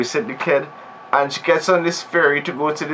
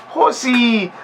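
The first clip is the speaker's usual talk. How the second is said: put-on high, squealed "Horsey!" like an excited child; childish squeal; 9 semitones higher than usual. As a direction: squeal it like a little kid. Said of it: "she's like".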